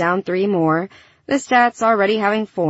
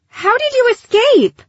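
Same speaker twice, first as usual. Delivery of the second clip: much higher, louder cry of disbelief; shocked outburst; 5 dB louder than usual. A narrator's reading. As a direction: demand it in high-pitched astonishment. Gilly confronts the escaped robot.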